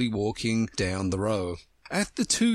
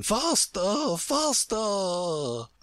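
high, slowly stretched queen's cry; urgent cry; 8 semitones higher, much slower than usual. A narrator's reading. Queen dragging Alice along, high urgent cry. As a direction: urge her on in a high, long-drawn cry. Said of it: "cried the Queen".

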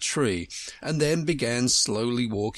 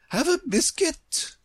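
very high queenly offer; good-natured Queen. A narrator's reading. Queen offering Alice a biscuit, high good-natured voice.

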